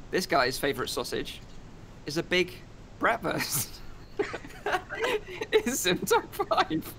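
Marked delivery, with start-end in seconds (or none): 4.2-6.9 words squeezed out through laughter; laughing. Real, not acted. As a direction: laugh while you say it. Host cracks up at own joke.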